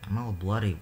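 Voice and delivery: ordinary talk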